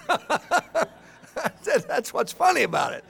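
laughing through the words; can't stop giggling as he tells it. Real, not acted. Laughing while retelling.

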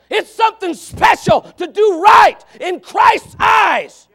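loud, high, hammering sermon cry; fiery preaching. Real, not acted. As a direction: preach it out at full force. Preaching at full force.

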